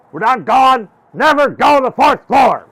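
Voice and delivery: high, loud stern order; Miss Dill Pickle. Acted. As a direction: lay down the rule at full volume. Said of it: "She goes".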